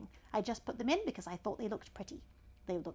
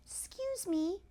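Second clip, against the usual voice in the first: much higher polite little voice; high child voice; 10 semitones higher, slower than usual. Acted. Elephant's child answering the python in a high voice. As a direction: squeak it politely.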